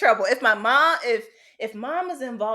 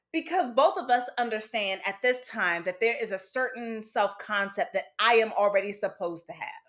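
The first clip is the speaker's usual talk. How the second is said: usual speaking voice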